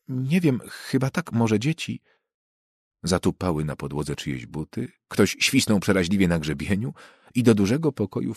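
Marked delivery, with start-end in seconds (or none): 0.1-2.0 lightly voiced, higher unsure woman; a hint of a flustered neighbour fumbling; flustered housewife. Mrs Parsons unsure. (lekko zagrana, zagubiona pani Parsons)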